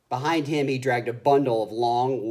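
ordinary delivery

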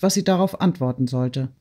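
ordinary delivery

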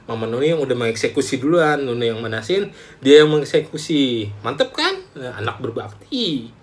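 ordinary talk

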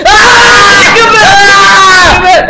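high loud crying shout; terrified cry. Real, not acted. Player terrified by the old man, loud crying shout. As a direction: scream out in terror.

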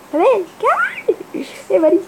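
squeaky high call. Real, not acted. Calling, voice jumps high.

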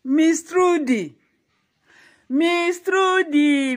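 slow, sing-song call of a name; drawn-out calling. Acted. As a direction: stretch the name out slowly, calling her. Calling out to scold Trudy.

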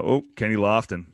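ordinary talk, nothing marked

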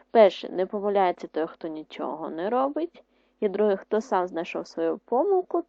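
ordinary delivery

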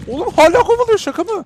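very high, loud disbelieving panic; being chased. Real, not acted. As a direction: squeal in disbelief while fleeing. Spider still chasing. (kovalanırken inanamayan panik)

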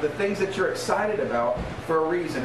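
normal talking voice

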